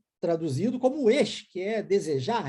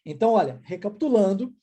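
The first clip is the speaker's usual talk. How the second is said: usual speaking voice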